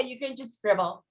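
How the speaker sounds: ordinary delivery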